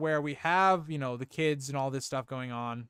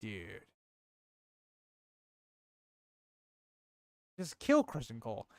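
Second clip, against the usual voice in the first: deadpan plea; 7 semitones lower, 7 dB quieter than usual. Retold or imitated. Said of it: "I'm like".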